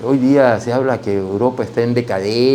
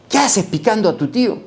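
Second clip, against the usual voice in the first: mock scolding; 11 semitones higher than usual. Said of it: "me jala, me dice".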